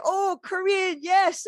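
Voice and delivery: high, delighted elder patient; light imitation of a warming-up patient; mock delighted recognition; pleased Korean patient. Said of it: "they'll be like".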